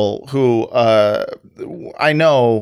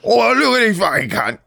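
higher mock British accent; 8 semitones higher than usual. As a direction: throw on a rough British voice. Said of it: "No, it's British".

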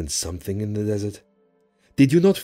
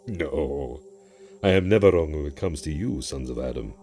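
low, softening, self-satisfied djinn voice; proud djinn; 6 semitones lower, 5 dB quieter than usual. Acted. Djinn boasting to a human, bristling with pride. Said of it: "bristling with obvious pride".

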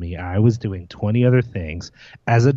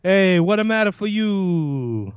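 drawn-out, high-pitched cartoon Italian-American; slow, sing-out Italian New York caricature; mock Italian-American accent; 8 semitones higher, slower than usual. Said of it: "It sounds like it would be called".

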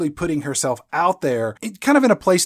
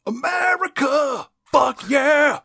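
high, drawn-out patriotic holler; mock patriotic shout; 11 semitones higher, slower than usual. Mocking the movie's flag scene, hammed-up patriotic shout. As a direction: bellow it like a hammed-up battle cry.

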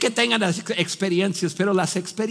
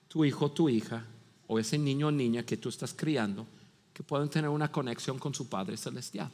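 soft, earnest preaching voice; earnest sermon; 5 semitones lower, 7 dB quieter than usual. Acted. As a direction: urge the listeners gently.

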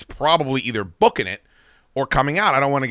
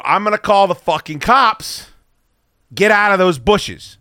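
higher, louder warning shout; raised threat into the dark; threatening yell; 7 semitones higher, 5 dB louder than usual. Retold or imitated. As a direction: scare off whatever is out there. Threatening intruder in bushes.

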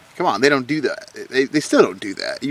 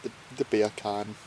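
soft, badly faked Jamaican accent; hesitant, half-hearted try at Jamaican; failed Jamaican accent; 2 semitones lower, 12 dB quieter than usual. Shown as a demonstration. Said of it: "I can't do it".

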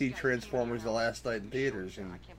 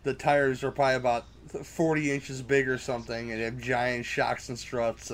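ordinary talk, nothing marked

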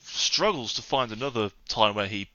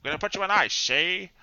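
higher, hammy tough guy voice; old-time gangster voice; 6 semitones higher than usual. Shown as a demonstration. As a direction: growl it like a movie mobster. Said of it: "sounds like a weird gangster voice".

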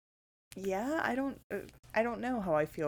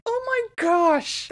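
astonished exclamation; 13 semitones higher, 7 dB louder, slower than usual. Retold or imitated. Retells own astonished reaction to reveal.

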